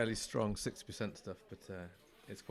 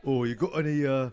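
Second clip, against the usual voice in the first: loud, high Cockney pub customer; hammed-up Cockney punter at the bar; Cockney accent; 4 semitones higher, 10 dB louder than usual. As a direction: order cider in a put-on Cockney voice. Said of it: "is a Cockney one".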